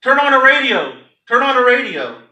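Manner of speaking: loud, high urgent shout; frantic yell from the parking lot. Retold or imitated. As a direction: burst in shouting the news. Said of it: "and yells".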